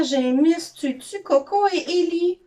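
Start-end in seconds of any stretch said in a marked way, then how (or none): none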